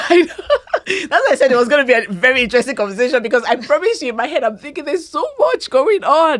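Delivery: high, loud, through real laughter; laugh, then gush on loudly at a high pitch; laughing agreement. Real, not acted. Host laughs at guest's remark, exclaims.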